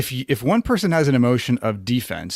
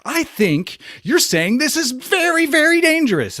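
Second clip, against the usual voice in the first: dramatic alarm; 4 dB louder than usual. Pretend. Acting out matching their alarm.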